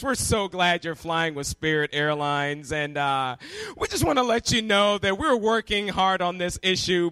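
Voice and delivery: mimicked gate agent, slow and low after the greeting; airline gate announcer. Gate agent announces a longer delay to passengers, announcing. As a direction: hail the room, then settle into a droning PA delivery. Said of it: "and guess what they say?".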